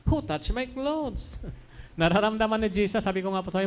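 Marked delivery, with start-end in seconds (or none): 0.1-1.4 slow, drawn-out, high questioning voice; slow wondering question. Acted. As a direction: ask it slowly, searching the crowd. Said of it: "and asked".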